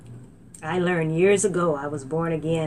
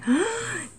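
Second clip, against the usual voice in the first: astonished gasp; 14 semitones higher than usual. Retold or imitated. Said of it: "I'm like".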